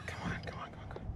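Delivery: breathless whisper, urging; barely voiced, coaxing the fish in; whispered pleading. Real, not acted. Angler whispering to the fish while reeling it in.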